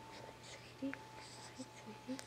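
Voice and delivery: whisper. Real, not acted. Grandma whispers to her newborn granddaughter, very soft. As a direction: hush it right down, just breath.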